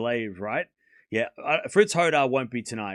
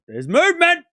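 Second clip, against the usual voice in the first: excited high call; 13 semitones higher, 8 dB louder than usual. Real, not acted. Streamer spotting movement on the rain-delayed court, excited high call. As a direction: shoot up high and loud, thrilled.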